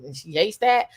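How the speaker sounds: usual speaking voice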